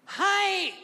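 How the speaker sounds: tiny, very high-pitched squeak; featherlight "Hi". Acted. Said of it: "So I go like this".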